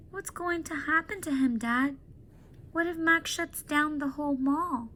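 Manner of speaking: put-on a girl saying goodbye to her friend; young girl Julia. Julia voiced higher.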